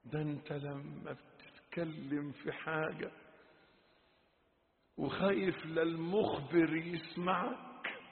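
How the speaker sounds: soft, paused reproach; quiet admonition. Real, not acted. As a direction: speak low-key, ending in a soft, low prayer. Drops to quiet reproach and prayer. (موعظة هادئة تنتهي بدعاء خافت)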